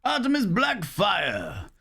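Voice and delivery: slow, high, drawn-out proclamation of the name; ceremonial shout. Pretend. Inscribing a subscriber's name, a slow ringing ceremonial shout. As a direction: call the name out like a ritual chant.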